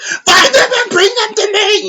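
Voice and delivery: mocking a buffoonish villain screaming at his minions; over the top screaming. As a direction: bellow orders like a cartoon tyrant. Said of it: "he's just doing over the top screaming".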